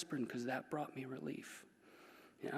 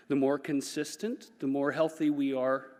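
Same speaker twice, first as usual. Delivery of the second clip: ordinary talk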